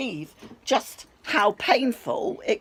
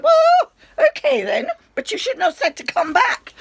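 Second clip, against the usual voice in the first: much higher and louder, half laughing in disbelief; indignant outburst; 9 dB louder than usual. Retold or imitated. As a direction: blurt it out, squeaky with indignation. Retorting to dismissive doctor.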